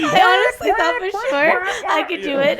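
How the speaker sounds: high, slow, laugh-choked confession; laughing helplessly. Real, not acted. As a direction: squeeze the words out through laughter. Laughing at own failed impression.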